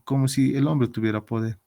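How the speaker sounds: usual speaking voice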